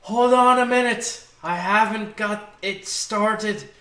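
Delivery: full-voiced in-character yell. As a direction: holler it across the battlefield. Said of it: "I would just like to yell out".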